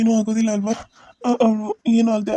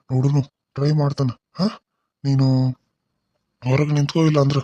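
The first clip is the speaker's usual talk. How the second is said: much lower, gruff-sounding doctor voice, blunt and businesslike; a country doctor sizing up a snakebite, low and steady; village doctor; 6 semitones lower than usual. Acted. Doctor tells Shashi the venom must not spread, low doctor voice.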